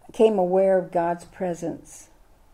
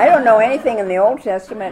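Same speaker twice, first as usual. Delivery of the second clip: laughing it out, louder and quick; 3 semitones higher, 10 dB louder, faster than usual. Real, not acted. Admitting ignorance to the class, laughing. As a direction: laugh through the confession, fast.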